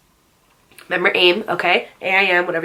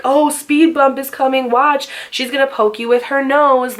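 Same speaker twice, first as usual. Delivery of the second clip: higher, louder, slow sing-song taunt; mocking classmates; 6 semitones higher, 3 dB louder than usual. Classmates mocking her in the hallway, louder sneering taunt. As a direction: play the jeering school kid.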